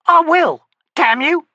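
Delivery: high, loud and furious; furious outburst. Acted. Stonny lashing out at Harlow, furious shout. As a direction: snap back in a rage.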